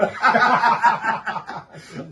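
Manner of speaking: loud, high laugh breaking out; a hearty real laugh; real burst of laughter. Real, not acted. Laughing at own shouting story.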